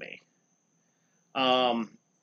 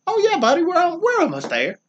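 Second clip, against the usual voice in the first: much higher and louder, airy brush-off; mock breezy Bob; 6 dB louder than usual. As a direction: play the cheery boss who won't let you leave. Imitating Bob brushing him off.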